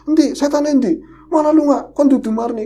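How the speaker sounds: high, loud angry demand; angry challenge to ghost. Retold or imitated. Brother confronting the ghost at the door, angry and loud.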